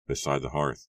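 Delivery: usual speaking voice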